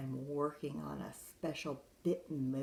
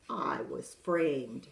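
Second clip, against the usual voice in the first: slow injured plea; 6 dB louder, much slower than usual. Acted. Wolf pleading innocence.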